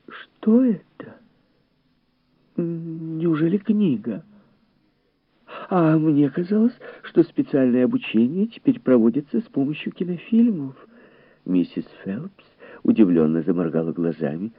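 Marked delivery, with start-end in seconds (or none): 0.1-10.7 high, wondering female voice; high-voiced polite surprise; astonished lady guest. A narrator's reading. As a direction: puzzle over it in a pitched-up lady's voice.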